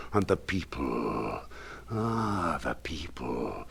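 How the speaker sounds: low, soft whisper, almost breathless; hushed whisper. Acted. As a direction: draw the listener in with a secret. Hushed awe at the ghouls.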